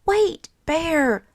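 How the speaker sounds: high, loud pleading call; high loud plea; begging. A narrator's reading. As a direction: beg him to stop. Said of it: "begged Franklin".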